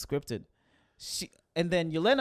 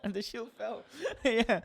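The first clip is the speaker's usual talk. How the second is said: higher, laughing through the words; 8 semitones higher, slower than usual. Real, not acted. Echoing her friend's joke, laughing. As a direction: echo the line while laughing.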